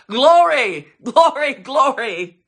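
loud, high repeated praise; exultant acclamation. Real, not acted. Preacher asks church to say it with him, exultant. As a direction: lead the crowd in full-voiced praise. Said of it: "Would you say it?".

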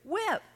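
with lifted high and held apart; single out with for the congregation, high stressed. Shown as a demonstration. The preacher marks the key word with, pitched high. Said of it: "and the word is".

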